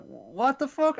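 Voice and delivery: retelling a character's stunned yell; mock shocked outburst. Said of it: "He's like".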